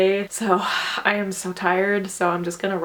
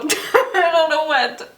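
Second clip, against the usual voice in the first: slow, laughing, at a loss; 6 semitones higher, 5 dB louder, slower than usual. Real, not acted. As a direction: trail off in giggles. Aimless after finishing.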